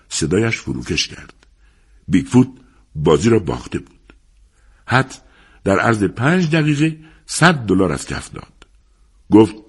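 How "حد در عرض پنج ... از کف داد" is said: plain reading voice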